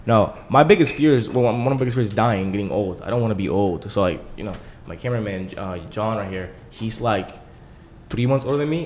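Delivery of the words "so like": ordinary talk, nothing marked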